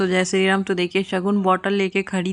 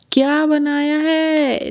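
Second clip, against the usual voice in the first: playful drawn-out question; 8 semitones higher, 4 dB louder, much slower than usual. Retold or imitated. Papa asks what was made.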